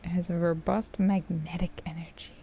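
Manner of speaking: breathy mock-mysterious whisper. Streamer whispering a game line to the shopkeeper. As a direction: whisper it like a secret.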